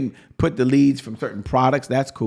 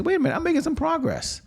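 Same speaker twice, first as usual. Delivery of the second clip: pleased surprise; 14 semitones higher than usual. Retold or imitated. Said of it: "Then other days you say".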